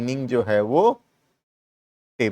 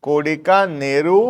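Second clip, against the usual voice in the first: slow dictation; much slower than usual. Shown as a demonstration. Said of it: "dictating the example slowly".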